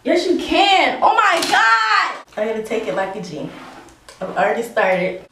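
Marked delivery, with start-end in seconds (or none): 0.0-2.2 loud, high, drawn-out outcry; dismayed outcry. Real, not acted. Seeing that the cut really shows, dismayed outcry. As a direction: cry out in dismay, stretching it.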